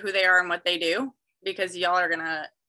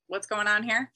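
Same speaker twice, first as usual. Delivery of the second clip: usual speaking voice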